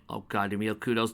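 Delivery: normal talking voice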